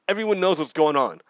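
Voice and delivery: usual speaking voice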